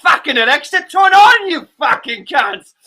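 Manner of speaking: shouted, high mock-British swearing; loud comic British accent, slowing on the insult; bad British accent. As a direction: yell abuse in a hammed-up British accent. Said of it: "That's my worst British accent ever".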